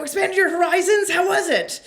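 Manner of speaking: high, loud, breathless excitement; bursting with excitement to hear the gossip; excited squeal. Retold or imitated. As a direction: gush at her like a giddy teen. Finally blurts the code phrase.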